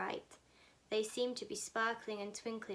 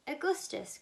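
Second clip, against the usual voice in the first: excited cry; 6 semitones higher, 4 dB louder than usual. A narrator's reading. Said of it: "cried Mister Wonka".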